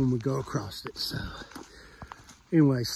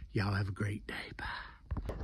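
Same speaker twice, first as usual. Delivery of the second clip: whisper; 7 semitones lower, 9 dB quieter than usual. Real, not acted. Signing off in a whisper.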